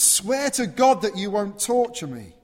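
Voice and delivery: loud, high, urgent cry; loud plea. Acted. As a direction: shout the plea out. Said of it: "he shouts that out".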